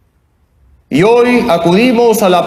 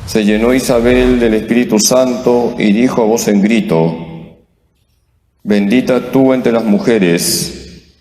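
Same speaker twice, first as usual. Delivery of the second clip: measured scripture reading, lower; gospel reading; 7 semitones lower than usual. Real, not acted. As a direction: read the gospel solemnly from the pulpit. Priest reads the gospel aloud.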